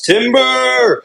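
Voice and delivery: high, drawn-out "Timber!" call; commentator's yell. Retold or imitated. Commentator calls a giant fighter's knockdown, long high yell. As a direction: holler it like a ringside commentator. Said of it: "the commentator yells".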